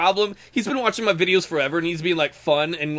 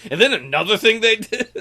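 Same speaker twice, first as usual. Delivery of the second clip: mock rant; 5 semitones higher, 5 dB louder than usual. Imitating Pixie opening up.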